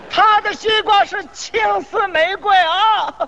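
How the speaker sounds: high, slow hawker's call; mock vendor's cry. Seller absurdly praising his rival's melon, high drawn-out vendor's cry. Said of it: "互相夸".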